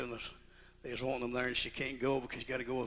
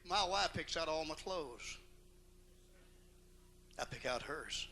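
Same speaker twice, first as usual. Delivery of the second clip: ordinary talk, nothing marked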